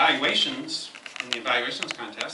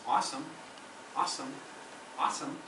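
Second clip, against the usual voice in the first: monotonous repetition; 7 dB quieter, faster than usual. Shown as a demonstration. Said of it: "I recommend practicing synonyms".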